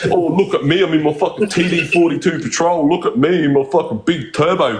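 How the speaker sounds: lightly voiced smug car bro, taunting; mocking show-off. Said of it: "I was just being a smart ass".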